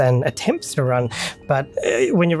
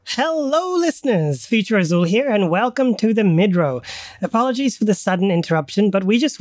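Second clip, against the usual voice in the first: bright podcast host intro; cheerful host announcement; announcer voice; 5 semitones higher than usual. Acted.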